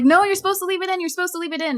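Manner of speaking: high-pitched, urgent imitation of a protesting kid; panicked mock protest. Voices other kid protesting the sword.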